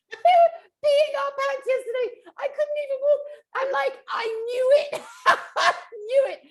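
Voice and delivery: very high-pitched, laughing squeal; cracking up. Real, not acted. Recalling how they laughed at the salon, high and giggly. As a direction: tell it through real laughter, way up high.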